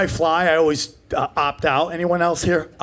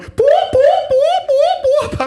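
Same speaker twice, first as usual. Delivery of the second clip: much higher, louder steady electronic boops; machine beeping; 5 dB louder, much slower than usual. Retold or imitated. At airport security, the detector's loud high beeping. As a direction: beep like an alarm going off.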